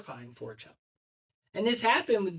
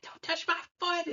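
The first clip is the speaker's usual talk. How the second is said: mock screaming; 12 semitones higher, 4 dB quieter than usual. Daughter fighting off dad over a splinter, mock screaming. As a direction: squeal it high, as a retold protest. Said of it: "She was screaming".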